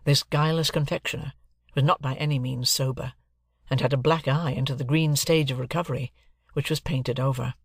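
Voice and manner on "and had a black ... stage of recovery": ordinary delivery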